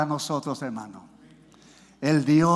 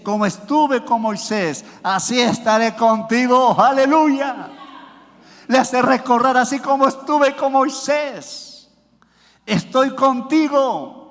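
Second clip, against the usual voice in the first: impassioned preacher, building to raised, louder voice; preaching crescendo; 5 semitones higher, 4 dB louder than usual. Real, not acted. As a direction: proclaim God's promise to the congregation, rising in force. (predicación exaltada, con voz creciente)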